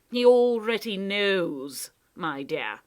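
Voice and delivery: slow, offhand loud reply; casual. Acted. Proc answers Lindy's threat, casual and smug. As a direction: toss it off without a care. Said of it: "the Proc replied casually".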